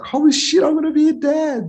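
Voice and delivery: sudden high squeal of joy at becoming a dad; retold excited outburst. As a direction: burst out like a giddy dad-to-be hearing the news. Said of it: "It's like".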